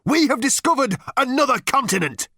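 high, loud proclamation; military announcement. A narrator's reading. Number Two reporting a discovery to captain, proclaiming.

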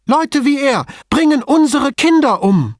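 very high, loud outraged cry; bursting out. A narrator's reading. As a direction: let it all burst out. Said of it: "auch dies musste noch heraus".

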